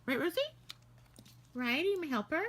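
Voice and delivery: high, sing-song pet voice; cooing at Rosie the dog; talking to the dog. Real, not acted.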